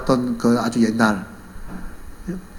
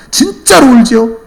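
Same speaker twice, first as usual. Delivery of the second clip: high, loud, drawn-out demand for assurance; insistent questioning; 9 semitones higher, 8 dB louder, slower than usual. Retold or imitated. Buyer presses the chicken seller once more, insistent. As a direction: push harder for a promise. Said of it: "또 묻습니다".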